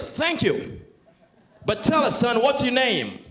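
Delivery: put-on the official thanking the boy; grateful official. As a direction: play a grand official addressing the boy. Official voiced.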